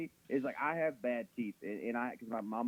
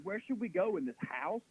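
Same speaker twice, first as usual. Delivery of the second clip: higher-pitched mock wondering; mock clueless character; 7 semitones higher than usual. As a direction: play a clueless horror character, lightly. Mocks clueless zombie-show characters.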